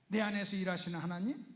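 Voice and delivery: ordinary delivery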